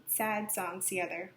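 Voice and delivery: ordinary delivery, nothing marked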